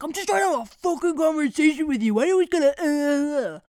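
loud, fast, exasperated rant; hammed-up angry outburst; mock freak-out. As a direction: imitate him blowing up. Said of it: "he freaked out".

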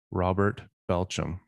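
ordinary delivery

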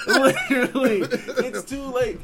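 high laughing, loud; laughing hard. Real, not acted. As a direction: crack up while saying it.

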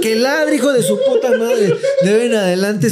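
high, laughing bark of an order; laughing mock shout. Voicing dad yelling at the dog.

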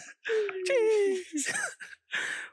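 squealing high laugh; high laughter. Real, not acted. Laughing at his own story, high squeal of laughter. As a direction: let the laugh run out high.

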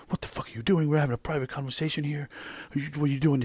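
retold angry yell. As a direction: imitate a boss snapping at an employee. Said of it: "he starts yelling at me".

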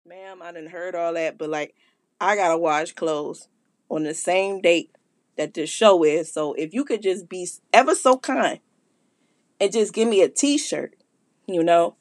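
low, lazy caller voice; a hint of an unbothered caller; lightly voiced caller. Caller brushing off the host in a low voice.

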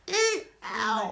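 high-pitched pained squeal and yelp; cringing in pain. Retold or imitated. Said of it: "I'm, like, cringing into the hole".

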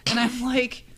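normal talking voice

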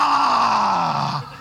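a short angry yell, "Ah!"; the shepherd roaring at the wolf; angry shout. Acted. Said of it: "He got really angry".

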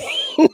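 high squeal of laughter; squeaky laugh. Real, not acted.

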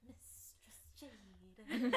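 slow, savoring each syllable; drawn-out seductive title. Pretend. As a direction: draw out the name teasingly. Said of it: "I just like saying it like that".

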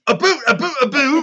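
imitating English people doing Canadian; mock Canadian accent. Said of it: "Let's do Canadian accents".

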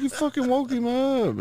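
put-on husband squealing at his wife for waking the baby; mock outrage. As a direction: act scandalised and disbelieving. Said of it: "I was like".

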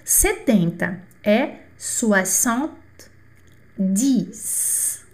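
soixante-dix spoken very slowly, swa-sant-DEES; slow model. Shown as a demonstration. Teacher introducing seventy in French, very slow. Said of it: "vamos lá".

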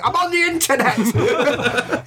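high, slow, laughing loony voice; a deranged bum who is hallucinating, drawn out; crazy voice. Retold or imitated. Voicing a mad bum character.

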